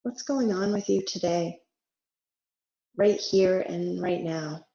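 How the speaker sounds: unhurried meditation voice with long pauses; guided meditation. Real, not acted. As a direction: gently guide them into rest, letting each phrase settle.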